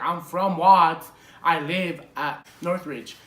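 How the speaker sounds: slow and loud, landing hard on "Watts"; pointed retort. Retold or imitated. Retelling the answer given to the cop, pointed retort. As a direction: spell it out slowly, "Watts" against "Northridge".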